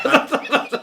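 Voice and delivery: laugh. Real, not acted. Laughing at a joke.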